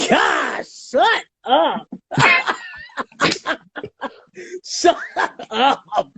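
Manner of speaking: laughing. Real, not acted. Playful teasing between hosts.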